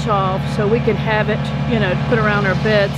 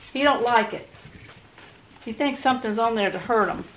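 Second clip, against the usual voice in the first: usual speaking voice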